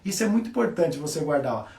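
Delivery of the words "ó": ordinary talk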